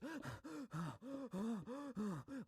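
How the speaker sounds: mock hyperventilation; imitated hyperventilating. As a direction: gasp in and out rapidly as if panicking. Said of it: "like, hyperventilating and shit".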